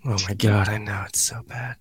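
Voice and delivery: whispered embarrassed aside. Real, not acted. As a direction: drop to a hushed confession. Embarrassed aside about bad start.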